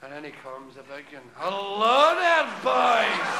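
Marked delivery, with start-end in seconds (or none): 1.3-3.3 louder, slow hearty greeting; Jesus breezing into the pub; grand entrance. Acted. Jesus enters.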